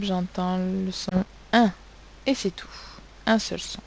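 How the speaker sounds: ordinary talk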